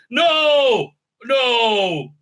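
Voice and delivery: shouted no, no; exasperated shouting no; loud no. Real, not acted. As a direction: yell it at the screen. Ranting at the TV show.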